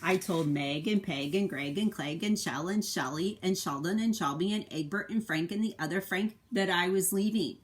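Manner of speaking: expressive picture-book narration; reading to children. A narrator's reading. Parent reading the egg's story to a child, expressive narration. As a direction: tell it to a little one, with feeling.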